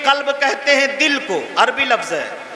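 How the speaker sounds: normal talking voice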